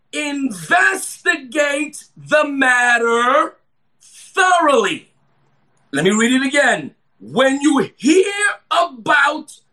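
very slow, drawn-out preaching cadence; emphatic scripture reading. Real, not acted. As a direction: stretch every phrase and pause before the next.

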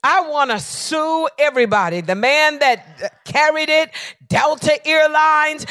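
loud, high furious yelling; angry shouting. Retold or imitated. Pastor on the phone with Delta, shouting angrily. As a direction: demand the supervisor at full voice. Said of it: "I am angry".

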